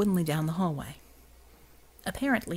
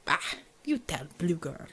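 put-on Russian accent; 3 semitones lower, 4 dB quieter than usual. Xavier dismissive, Russian accent.

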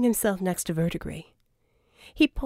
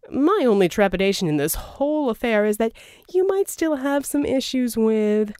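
louder, smooth persuasive schemer; a charming villain's pitch, louder; Verdigris voiced; 3 semitones higher, 5 dB louder than usual. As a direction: sell the deal, oily and grand. Verdigris hedging.

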